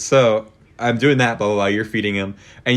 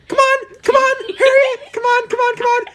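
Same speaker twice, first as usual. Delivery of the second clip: imitating mom calling; 3 dB louder than usual. Son mimicking mom calling geese, high coaxing call. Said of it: "hear Mom for, like, ten minutes going".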